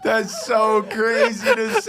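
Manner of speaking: laughing high and slow. Real, not acted. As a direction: say it while cracking up. Laughing reaction.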